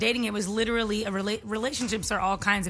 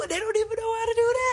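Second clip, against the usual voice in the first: high mocking; 12 semitones higher, faster than usual. Real, not acted. Mocking old men texting.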